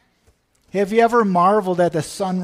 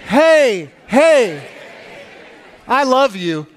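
raised voice, calling out love; loud affectionate call; 4 semitones higher, 8 dB louder, much slower than usual. Shown as a demonstration. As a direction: call it out so they really hear it. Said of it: "and say".

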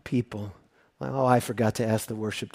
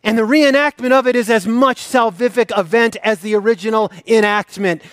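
loud, high, ringing sermon voice; full-force preaching; 8 semitones higher, 8 dB louder than usual. Acted. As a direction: preach it out to the whole church.

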